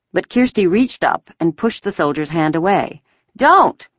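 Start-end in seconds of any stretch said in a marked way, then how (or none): 3.4-3.9 high, loud burst of refusal; loud protest. A narrator's reading. Five-year-old pushing a soldier's hand away, loud protest. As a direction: cry out like a defiant small child. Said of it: "she said loudly".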